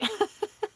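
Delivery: short, high giggle; amused giggle. Real, not acted. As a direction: giggle lightly mid-sentence. Amused by own pink cheeks.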